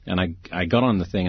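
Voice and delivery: ordinary delivery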